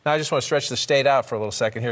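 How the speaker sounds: ordinary talk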